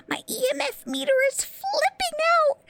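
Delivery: high, excited burst. Acted. Pixie excitedly telling boss the meter is going off. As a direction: squeal it as the meter spikes.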